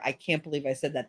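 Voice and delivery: normal talking voice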